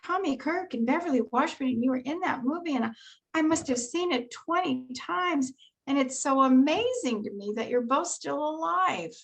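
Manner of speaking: high, amazed fan voice with swooping emphasis; gushing fan. Retold or imitated. Fan marvels that both child stars are still alive, gushing. Retelling a fan's gushing remark.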